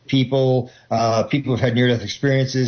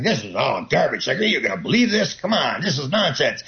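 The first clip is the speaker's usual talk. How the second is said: sceptical inner voice. Retold or imitated. Hypnotist describing the inner critic, lightly voiced scoffing. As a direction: voice the doubting little voice in your head. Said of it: "the little voice is going".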